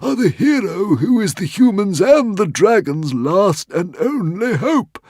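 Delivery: high, loud earnest dragon voice; solemn proclamation. A narrator's reading. Dragon telling Hiccup he is the hero, high and earnest. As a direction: crown him the last hope.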